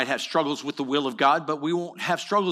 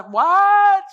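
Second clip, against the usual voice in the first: sharp high yelp of surprise, much louder; sudden surprise; 10 dB louder than usual. Acted. Preacher catching himself mid-thought, startled high outburst. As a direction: jolt up in pitch as if caught off guard.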